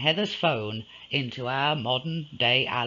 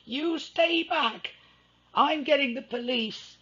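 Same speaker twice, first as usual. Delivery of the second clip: warned; 13 semitones higher than usual. Acted. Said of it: "she warned".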